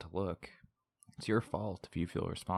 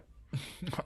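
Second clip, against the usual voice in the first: short airy scoff of a laugh; breathy mocking laugh; 9 semitones higher, 5 dB quieter than usual. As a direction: laugh her words off. Villain mocks her accusation.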